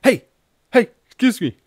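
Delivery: retold hail to a passing stranger; calling out. Calling out to the guy in the communist shirt. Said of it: "And I was like".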